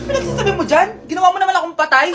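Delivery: loud, laughing mock-outrage; laughing scold. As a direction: scold him while laughing. Mother scolds fake graveside drama. (natatawang pagsaway)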